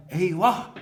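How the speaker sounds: sharp startled eyvah; alarmed cry. Shown as a demonstration. Teacher showing an exclamation, alarmed cry. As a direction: blurt it like a sudden fright. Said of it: "Nasıl mesela?".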